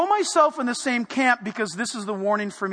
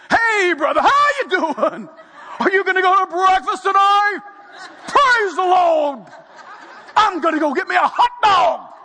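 overblown preacher voice; 7 semitones higher, 5 dB louder than usual. Shown as a demonstration. Preacher showing how nobody talks to friends, overblown pulpit shout. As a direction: bellow small talk like a revival preacher. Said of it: "None of us talk to one another like this".

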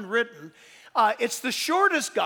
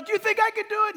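shouting across; 9 semitones higher, much faster than usual. Retold or imitated. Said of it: "he shouts across".

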